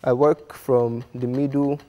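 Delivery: normal talking voice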